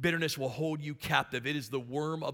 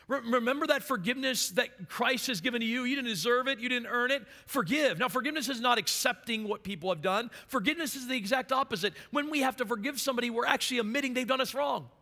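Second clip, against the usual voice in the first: high, raised sermon voice; fervent preaching; 10 semitones higher, 5 dB louder than usual. Real, not acted. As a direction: urge the congregation with driving, emphatic force. Preaches at full force on forgiveness.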